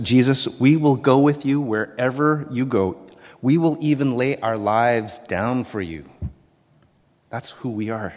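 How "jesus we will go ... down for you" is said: insisting loudly. Retold or imitated.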